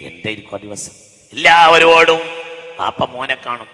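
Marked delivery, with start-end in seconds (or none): 1.3-2.2 ringing sermon voice; full-force preaching. Acted. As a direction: preach it to the hall.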